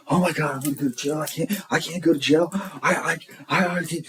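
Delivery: mock panic, high-pitched and stammering; imitated freak-out. Retelling his friend Matt panicking in the car, mock freak-out. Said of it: "He's freaking out. He's like".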